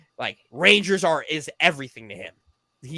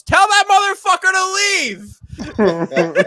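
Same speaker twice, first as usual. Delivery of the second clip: high, loud laughing mock yell; hammed-up angry stepdad; imitating stepdad yelling; 13 semitones higher, 5 dB louder than usual. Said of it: "He goes".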